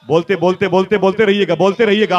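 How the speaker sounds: loud, high urging; urging the crowd. Real, not acted. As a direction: rouse the crowd to say it. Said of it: "urging the crowd". (ज़ोर से, ऊँची आवाज़ में जोश दिलाना)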